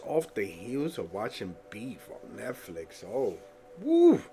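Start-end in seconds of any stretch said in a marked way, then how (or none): 3.8-4.2 high shouted woo of delight; excited whoop. Real, not acted. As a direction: let out a happy holler. Excited whoop after Beef.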